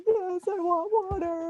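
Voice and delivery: imitating her own slow, high teary plea; tearful plea for water, replayed half in jest; retold crying. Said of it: "started to cry".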